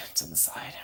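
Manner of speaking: unvoiced whisper of the phrase; secretive whispered aside. Shown as a demonstration.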